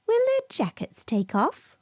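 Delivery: put-on high little girl's question; wide-eyed child. A narrator's reading. Lucy asking Mrs Tiggywinkle in surprise. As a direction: ask it with childish amazement.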